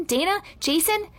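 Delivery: high questioning call; mild, high-pitched shout. A narrator's reading. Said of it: "shouted Allison".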